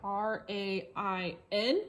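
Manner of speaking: ordinary talk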